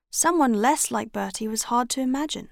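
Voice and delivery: plain reading voice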